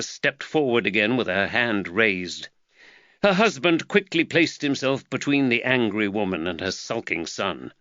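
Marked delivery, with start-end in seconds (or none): none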